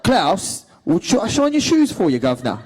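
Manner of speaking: hammed-up loud, high Cockney; Cole. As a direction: play a rough Cockney bootblack. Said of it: "more of a Cockney".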